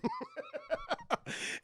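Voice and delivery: light, high-pitched chuckle; quick high burst of laughter. Real, not acted. Storyteller amused by own god impression, high laugh.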